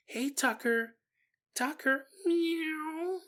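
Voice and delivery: high cooing call to a kitten; high-pitched kitty talk; coaxing the cat. Retold or imitated. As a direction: coo sweetly to the cat. Owner coaxing her cat.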